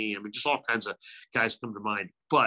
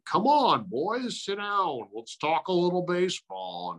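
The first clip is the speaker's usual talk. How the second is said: Harry Kalas voice; 8 semitones higher than usual. Retold or imitated. Imitating Harry Kalas inviting.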